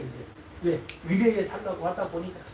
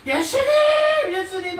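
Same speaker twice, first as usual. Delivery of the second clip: high, loud desperate call; calling out to Jesus; 11 semitones higher, 8 dB louder than usual. Acted. Sick man calls out to passing Jesus, high and loud. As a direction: shout after Jesus as he passes.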